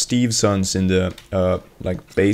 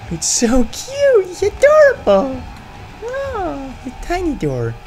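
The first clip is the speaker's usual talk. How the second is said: gushing delight. Real, not acted. Gamer gushing over a tiny door, squealing delight. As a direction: coo over something adorable.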